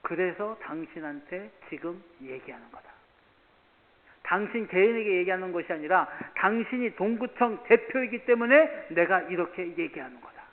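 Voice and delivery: stern retold scolding. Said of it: "왜 그런지 얘기를 했어요".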